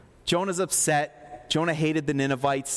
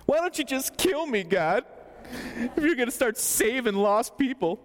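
high, whining protest; a pouting complaint to God; whiny Jonah; 6 semitones higher than usual. Acted.

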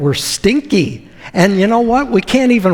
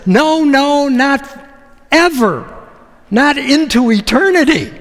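absolute refusal; 6 semitones higher than usual. Retold or imitated. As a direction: dig in, refuse forever. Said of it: "Peter is absolutely refusing".

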